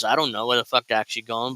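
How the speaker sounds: ordinary talk, nothing marked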